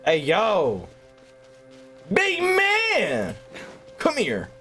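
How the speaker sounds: normal talking voice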